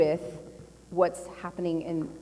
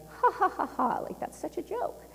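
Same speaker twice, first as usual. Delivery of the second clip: high, fake ha-ha scoffing; dismissive mock laugh; 10 semitones higher than usual. Newsroom downplaying UFOs, mocking laugh. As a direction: imitate someone laughing it off in a high voice. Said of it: "they would downplay it like".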